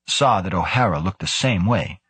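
usual speaking voice

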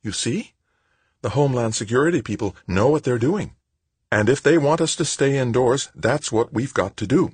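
a mother gently laying down the law; lightly voiced mother. A narrator's reading.

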